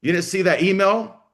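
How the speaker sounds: high, loud pretend blow-up at a parent; half-joking furious outburst; mock telling-off. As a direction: unleash the rant you'd never really say, pitched high and loud. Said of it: "What I really wanna say is".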